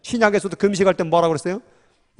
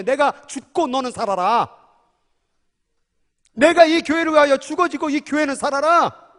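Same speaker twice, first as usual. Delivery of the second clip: earnest vow; 7 semitones higher than usual. Retold or imitated. Earnest self-sacrificing vow.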